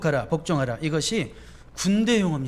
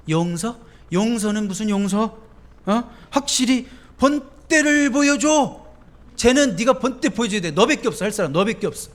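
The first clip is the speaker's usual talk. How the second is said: much higher, louder, scornful devil; devil's voice; 6 semitones higher, 6 dB louder than usual. Retold or imitated. Preacher voicing the devil goading revenge, high and scornful. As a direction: jeer and goad like a tempter. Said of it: "막 이런 식으로 얘기해".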